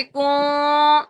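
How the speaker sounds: کون with the n held in the nose; nasal ghunnah. Shown as a demonstration. Teacher models for students, long nasal n in کون. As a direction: draw out a nasal hum on کون. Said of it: "اس طرح پڑھنا ہے".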